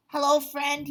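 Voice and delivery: high, loud friendly dragon voice; Duncan greeting. Acted. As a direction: greet the raccoon brightly. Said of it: "he said".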